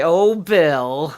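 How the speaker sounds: loud, high mock-exasperated retort; mock reply. Pretending to answer Bill's teasing. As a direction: toss it back at him with a big put-on sigh. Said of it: "and I say".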